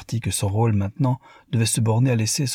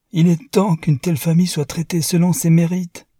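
much higher and louder, biting; strident retort; 9 semitones higher, 5 dB louder than usual. A narrator's reading. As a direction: fire it back with a harsh edge. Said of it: "riposta Newman avec un rire strident".

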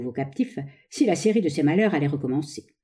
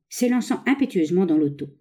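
ordinary delivery, nothing marked

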